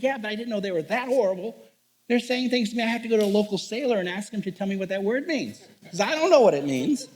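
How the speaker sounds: high-pitched, comically aggrieved retort; hammed-up bewildered griping; exasperated protest. Retold protest to his mentor.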